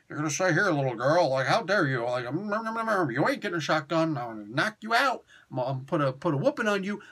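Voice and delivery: mock tough-guy threats; lightly put-on mumbling tough talk; [name]. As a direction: imitate an out-of-character bully. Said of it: "He ain't talking like that".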